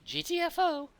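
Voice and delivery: very high, quick little cat creature voice; high squeaky cat voice. Acted. GM voicing the little cat guide pointing out the boss, squeaky high. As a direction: squeak it out like a tiny excitable cat. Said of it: "He looks at you guys".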